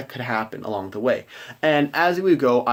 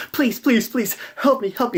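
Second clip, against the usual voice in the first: high, frantic pleading mother; a panicked mother begging for help, much higher; 11 semitones higher than usual. Acted. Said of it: "she's frantically like".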